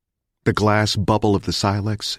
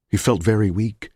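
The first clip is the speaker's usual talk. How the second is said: plain reading voice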